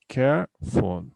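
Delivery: "careful" slowly split as care, ful; two separate syllables. Shown as a demonstration. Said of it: "we have only two syllables".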